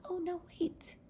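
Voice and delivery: very high, small mouse voice; tiny mouse plea. Retold or imitated. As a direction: squeak out a mock plea to be spared. Mouse pleads not to be eaten.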